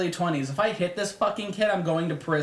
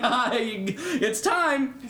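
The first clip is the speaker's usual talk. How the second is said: slow drawn-out psycho greeting, higher; mock menacing; 6 semitones higher, much slower than usual. Hothead showing his sudden rage, slow mock menace. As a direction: play the switch to crowd killer, slowly. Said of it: "to, like, crowd killing".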